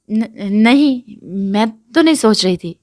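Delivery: shaky, startled denial, a bit loud; flustered denial. Acted. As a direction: trip over the first word, caught out. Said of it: "جلدی سے لرزتے بولا".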